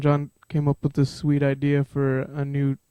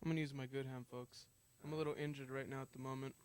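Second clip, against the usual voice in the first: mic handed over; 18 dB quieter, faster than usual. Real, not acted. Sam just took the mic.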